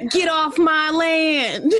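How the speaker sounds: cranky hermit witch with cackles; old mountain witch. Pretend. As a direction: shoo them off her land. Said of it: "the old witch of the mountains".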